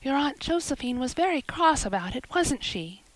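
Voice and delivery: usual speaking voice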